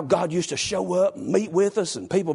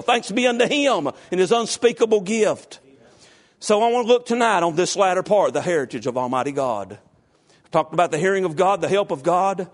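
normal talking voice

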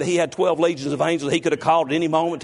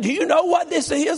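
very high, shouted question; mock irate wife. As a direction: imitate an irate wife, pitch shooting up. Retold squabble, repeated more sharply.

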